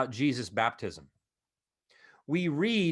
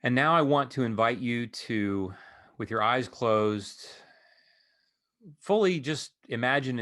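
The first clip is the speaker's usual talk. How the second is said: calm voice guiding eyes-closed imagery; guided meditation; 4 semitones lower than usual. Acted. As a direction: ease them into stillness one instruction at a time.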